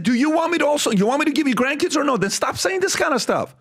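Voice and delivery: high, urgent ultimatum to a father; retold argument. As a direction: re-enact pushing back hard, pitch way up. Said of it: "One of the biggest fights I ever had".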